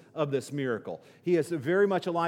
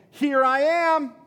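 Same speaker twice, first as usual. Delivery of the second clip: higher, louder, slow triumphant call; joyful announcement; 11 semitones higher, 9 dB louder, slower than usual. Acted. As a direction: announce yourself with delight, stretching it out. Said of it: "Jesus is saying".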